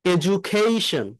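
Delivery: slowly, with the d said as j; drawn-out teaching demo of এডুকেশন; slow model word. Shown as a demonstration. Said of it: "এই ডিউটা জ উচ্চারণ হয়".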